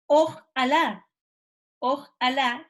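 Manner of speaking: "ojalá" broken as oj-alá, final LÁ stressed; split into parts. Shown as a demonstration. Explaining the link with Alá, "ojalá" split as oj-Alá. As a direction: slowly separate "ojalá" into "oj" and "Alá". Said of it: "con Alá".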